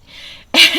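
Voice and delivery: a burst of real laughter; brief laugh at her own sarcasm; short laugh. Real, not acted. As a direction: laugh at the absurdity.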